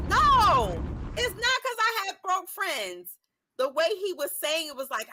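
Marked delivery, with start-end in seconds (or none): 0.1-3.2 indignant. Real, not acted. Rebutting commenters, indignant.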